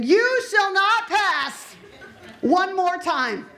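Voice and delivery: booming, high, slow wizard command; mock Gandalf bellowing at the door; Gandalf impression. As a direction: thunder it like Gandalf barring the way.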